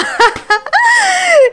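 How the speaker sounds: high, loud shrieking laugh; helpless cackling shriek; loud cackle. Real, not acted. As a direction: burst out laughing at the joke. Bursting laugh at the insult.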